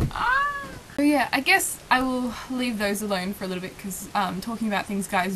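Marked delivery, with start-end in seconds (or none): 0.1-0.5 high-pitched delighted squeal; excited squeal. Shown as a demonstration. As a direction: squeal like a swooning fan. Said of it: "things they do that makes me just like".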